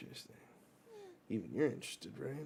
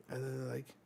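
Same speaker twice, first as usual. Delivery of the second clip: ordinary delivery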